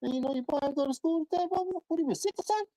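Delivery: high, fast nosy neighbor voice; gossiping neighbor. Retold or imitated. Neighbor spreading word that a boy skipped school, high and fast. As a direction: pitch way up and rattle it off like a busybody auntie. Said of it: "they telling everybody".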